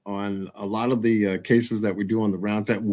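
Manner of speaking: usual speaking voice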